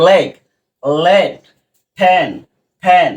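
"লেগ, লেট, ফ্যান, ফ্যান" as separate words with short "e" vowels; short E sound. Shown as a demonstration. Teacher modelling CVC words for pupils, short E sound. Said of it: "এ এর মতন উচ্চারণ হয়েছে".

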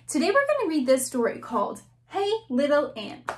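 ordinary delivery

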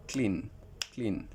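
"ক্লিন" as a single beat with falling tune; "ক্লিন" undivided, one falling syllable each time. Shown as a demonstration. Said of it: "একটা তুড়ি বাজাইতে পারবো".

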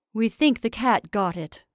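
plain reading voice

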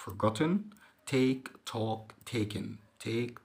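usual speaking voice

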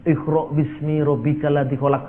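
Iqra bismi rabbikal ladzi khalaq recited softly in Arabic sounds; Arabic recitation. Shown as a demonstration. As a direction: recite it like Quran reading. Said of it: "didikte kepada malaikat".

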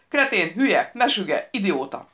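loud string of insults; jeering, punched-out abuse; name-calling. A narrator's reading. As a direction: hurl each word hard. Rescued character hurls string of insults.

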